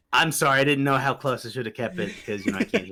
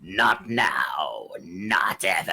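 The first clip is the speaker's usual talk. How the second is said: low, menacing villain voice; Palpatine; 6 semitones lower, much slower than usual. Acted. Villain answering Anakin, low and menacing. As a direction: sink low and refuse. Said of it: "that's actually really good".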